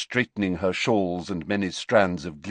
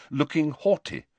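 ordinary delivery

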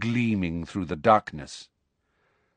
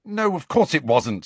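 impatient, snapped a touch higher; 8 semitones higher, 3 dB louder than usual. A narrator's reading. Harry to friends, impatiently dismissing their worry. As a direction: brush the idea off impatiently. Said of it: "said Harry impatiently".

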